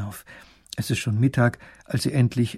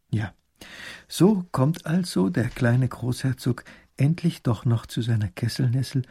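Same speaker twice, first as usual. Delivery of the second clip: plain reading voice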